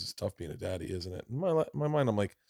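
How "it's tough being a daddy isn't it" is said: soft, low sympathetic voice; tender comfort. Retold or imitated. [name] quietly consoling the crying father. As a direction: gently console the crying dad. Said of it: "she says".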